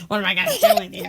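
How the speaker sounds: loud, laughing exasperation; playful despair breaking into laughter; laughing mock despair. Real, not acted. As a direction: say it loudly through a laugh.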